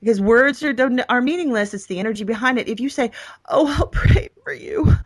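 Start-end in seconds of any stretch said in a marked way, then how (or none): 3.5-4.9 slow, higher, syrupy pity. Shown as a demonstration. Showing listeners the wrong way to pray for someone, syrupy pity. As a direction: drag it out with fake sympathy. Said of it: "you are absolutely an emotional terrorist".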